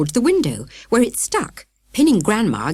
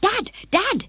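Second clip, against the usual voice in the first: high, eager shouting; excited shout. A narrator's reading. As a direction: call Dad urgently. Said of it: "he shouted".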